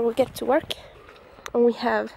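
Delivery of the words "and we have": normal talking voice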